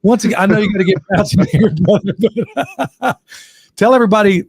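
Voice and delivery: laughter breaking up the words; laughing. Real, not acted. Host wrapping up with his buddy, laughing as he talks. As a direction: talk through real laughter.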